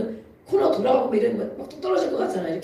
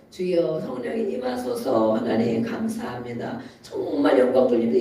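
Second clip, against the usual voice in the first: calm easy prayer; 9 semitones lower than usual. Retold or imitated. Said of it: "이러면 돼요".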